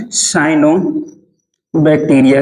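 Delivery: Cyanobacteria stretched, with a pause inside; cyanobacteria at writing-down speed; slow dictation. Shown as a demonstration. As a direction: dictate cyanobacteria slowly in two parts. Teacher dictating term for notes.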